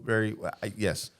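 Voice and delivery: ordinary talk, nothing marked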